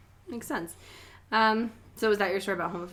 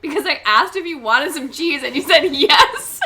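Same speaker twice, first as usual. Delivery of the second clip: loud, raised mock-exasperated reply; higher, emphatic, breaking into a laugh; retold exasperation; 5 semitones higher, 9 dB louder than usual. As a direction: act out insisting, slowing on "yes".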